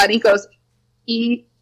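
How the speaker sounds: normal talking voice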